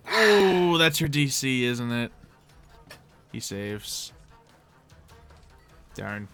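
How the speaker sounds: usual speaking voice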